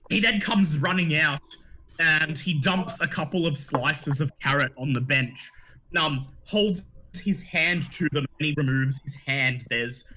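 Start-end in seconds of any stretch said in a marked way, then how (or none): none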